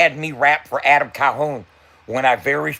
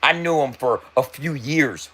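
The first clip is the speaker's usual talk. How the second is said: ordinary talk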